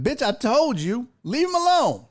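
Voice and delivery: retelling shouting down a bully; angry yell. Retold or imitated. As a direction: bark it like you're standing over him. Retold yelling at the bully.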